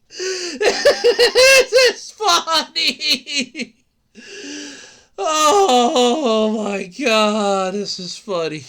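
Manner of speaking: drawn-out wheezing laughter; laughing. Real, not acted. Streamer laughing at NFTs, slow laughing. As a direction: laugh through the words.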